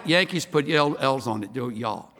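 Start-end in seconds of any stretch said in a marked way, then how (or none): 1.7-2.1 "y'all" low, ending open with no L; Southern "y'all". Shown as a demonstration. Native correcting Yankees on "y'all", L left silent. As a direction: drop the L from "y'all". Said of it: "Don't put an L on it".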